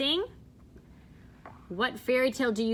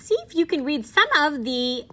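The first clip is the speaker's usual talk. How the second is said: usual speaking voice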